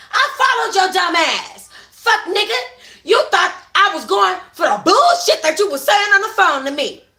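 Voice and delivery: high, loud furious yell; scorned woman yelling at cheating man. Acted. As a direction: yell it while smashing windows. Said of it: "she yells".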